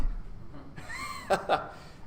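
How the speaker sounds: real chuckle at own joke; short stretch of real laughter; amused laugh. Real, not acted.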